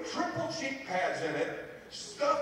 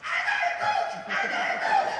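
put-on very high, loud, laughing boy's voice; excited boy shouting; 6 dB louder than usual. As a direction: squeal it like a thrilled kid. Boy shouting back to coach.

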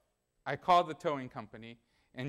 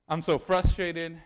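fed-up outburst; 2 semitones higher, 8 dB louder than usual. Retold or imitated. Quoting own exasperation.

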